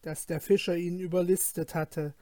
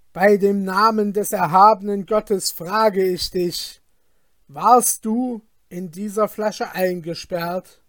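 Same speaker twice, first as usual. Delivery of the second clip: stern fisherman; 8 dB louder than usual. Acted. Fisherman questions the genie.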